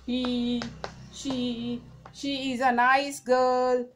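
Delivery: very slow, word by word; slow example read. Shown as a demonstration. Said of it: "third person में क्या आएगा?".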